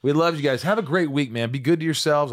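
podcast host booming a happy goodbye to the fans; cheery sign-off. Real, not acted. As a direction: send them off with a big happy wave. Big cheery sign-off to listeners.

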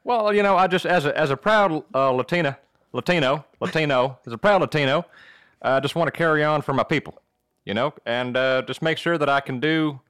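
earnest, halting Southern speechmaking; Southern accent. Acted. Panelist answering about his future, Southern accent. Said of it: "in your Southern accent".

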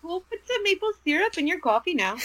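high, laughing mock Canadian accent that slides toward Swedish. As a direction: joke in a put-on Canadian accent that comes out Swedish. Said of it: "I don't know why I got Swedish".

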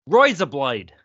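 Aussie-accented "Roy's a blade"; Australian accent. Shown as a demonstration. Showing friends the word that gets you into an Australian accent. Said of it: "one word and it gets you into it".

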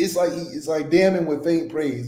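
ordinary talk

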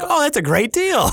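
high, drawn-out fake enthusiasm; sarcastically thrilled; mock delight. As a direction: gush over a terrible offer. Mock delight at scam fee.

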